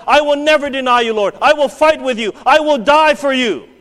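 loud, high bold promise; boastful vow. Acted. Peter boasting to the Lord, loud and high. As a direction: proclaim your loyalty proudly.

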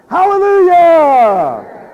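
high, loud, slow hallelujah; exultant shout. Real, not acted. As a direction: burst out in joy. Preacher exults.

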